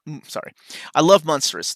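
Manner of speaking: ordinary delivery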